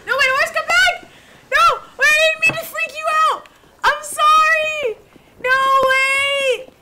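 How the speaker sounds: high, loud apology shouted after the horse; pleading yell at the fleeing horse; frantic pleading. Real, not acted. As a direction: beg the horse to come back. Horse flees after being spooked.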